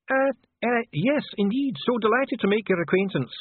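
high, hesitant dwarf courtier; caught-off-guard Dee. A narrator's reading. Dwarf greeting the duchess, caught off guard by charm. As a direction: stumble into politeness in a high-pitched voice. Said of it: "Dee murmured, caught off guard".

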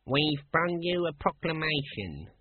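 lightly voiced officious guard, high and slow; palace guard. Palace guard announces a proclamation.

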